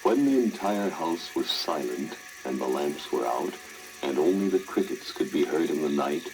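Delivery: ordinary delivery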